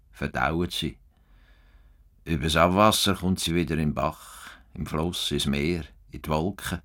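lonely man explaining the world to his cow, tender; tender musing to a cow. Acted. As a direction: talk gently to Rosa as if she understands. Muses softly to his cow.

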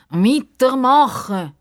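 high, loud, drawn-out call; stretched-out shout of encouragement; called command. A narrator's reading. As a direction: call out to keep them going. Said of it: "hat dann der Justus gerufen".

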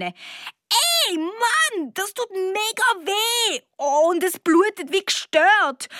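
high, indignant yelp of pain; pained outcry. Acted. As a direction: complain sharply at being hurt, pitch way up. Child hit on the nose, hurting.

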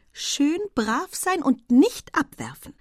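slow, high sing-song admonishing; almost motherly. A narrator's reading. Estelle on his shoulders, motherly warning not to drop her. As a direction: tell him to behave like a small boy. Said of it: "Es klang schon fast mütterlich".

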